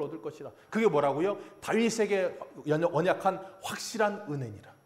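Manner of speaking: loud, rising sermon climax; fervent preaching. Acted. Pastor urging the congregation, loud fervent preaching. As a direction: preach it out to the whole church.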